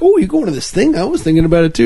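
high mock friend voice. Imitating a friend texting about the event, high and chirpy. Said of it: "it's like".

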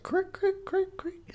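high, quiet squeaky creaks; imitated floorboard creaking. Imagining sex on a wood floor, imitating its creaks. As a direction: squeak softly like a creaking plank. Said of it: "one of those planks that creaks".

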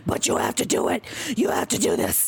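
lightly voiced hissing insistence; a hint of a harsh inner voice ordering him; a stern other voice. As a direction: push him like a second personality. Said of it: "voice goes".

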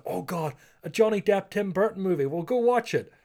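high-pitched imitation of eager moviegoers; mock excitement. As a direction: pitch it up, like a fan keen to go. Acting out eager past moviegoers.

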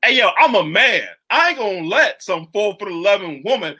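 loud, high, hammed-up tough guy; mock macho man. Men mocked for refusing a woman's lead, blustering. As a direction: puff up like an offended man. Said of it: "some men were, like, low-key".

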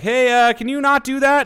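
high, loud nervous plea; nervous passenger. Retold or imitated. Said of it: "And Bo was like".

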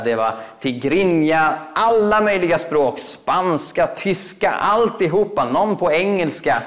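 usual speaking voice

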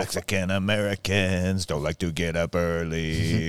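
slow, lowering drawl into the punchline; deadpan slow joke. Pretend. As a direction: draw the words out as if too lazy to hurry. Racial joke told slowly.

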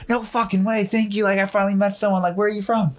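high, gushing excitement; excited meeting. Acted. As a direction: act thrilled to meet a fellow countryman. Imagined thrilled Australian meeting them.

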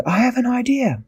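high-pitched eureka moment; bright-idea exclamation. A narrator's reading. Tweak thinks of a rescue, high excited exclamation.

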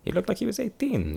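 very high, protesting repeat; high-pitched excuse. Acted. Traveller justifying giving a child a sword, high-pitched protest.

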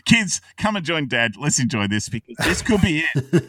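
put-on rally the kids to celebrate; joyful dad calling out; excited call.

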